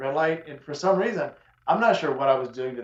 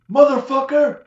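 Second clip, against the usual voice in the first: much higher, louder cursing shout; angry shout; 11 semitones higher, 10 dB louder, slower than usual. Retold or imitated. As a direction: yell the curse out high. Driver swears at him.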